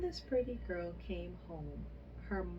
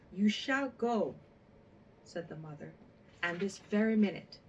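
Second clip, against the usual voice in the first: much louder, fast order; stern command; 8 dB louder than usual. A narrator's reading. A mother ordering her sulky daughter to the fountain now, loud stern order. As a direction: bark the command so there's no arguing.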